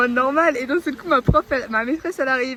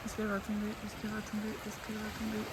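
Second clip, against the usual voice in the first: murmure tendu; 3 semitones lower, 14 dB quieter, faster than usual. Real, not acted. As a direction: tout bas, plein de suspense. Suspense, à voix basse. (à voix basse, suspendue)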